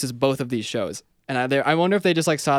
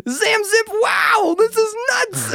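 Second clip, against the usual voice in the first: much higher, louder gushing host voice; over-the-top host; 4 dB louder, much slower than usual. Retold or imitated. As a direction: ham up the annoying gregarious host. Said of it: "overly gregarious, pretty annoying lady ... always like".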